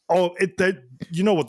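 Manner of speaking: ordinary talk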